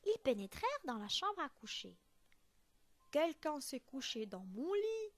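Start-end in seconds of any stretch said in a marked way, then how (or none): 3.1-5.1 louder heavy papa bear; très grosse voix. A narrator's reading. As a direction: boom it out like a huge bear. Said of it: "de sa très grosse voix". (grosse voix de grand ours)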